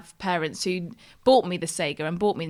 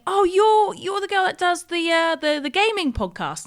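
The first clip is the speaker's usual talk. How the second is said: high, bright gushing fan; excited fan voice; 9 semitones higher, 3 dB louder than usual. Retold or imitated. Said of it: "feedback from people who I meet".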